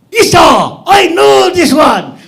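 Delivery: high, loud, slowed yell of excitement; hammed-up overexcited yell; eager shout. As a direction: shout it like a quiz show contestant who knows. Eager dead guy answering.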